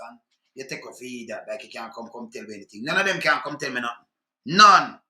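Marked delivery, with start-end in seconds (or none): 4.5-5.0 angry shout. Real, not acted. Ranting man shouts at the interviewer. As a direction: bark the single word hard.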